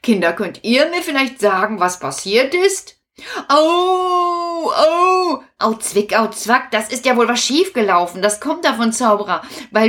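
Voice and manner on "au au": pained yelps. Acted. Wizard hurt by pinch.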